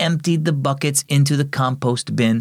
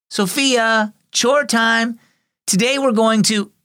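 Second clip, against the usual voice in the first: lightly voiced aunt, raised a touch higher; aunt calling her niece; 11 semitones higher than usual. Aunt calling Sophia to chores, lightly voiced higher. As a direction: call out to Sophia for chore time, friendly.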